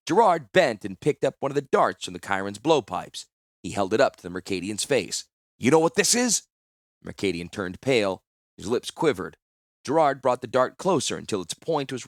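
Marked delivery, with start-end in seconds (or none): 5.6-6.4 high, loud threat; menacing question. Acted. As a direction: threaten the prisoner. Threatening with poison dart.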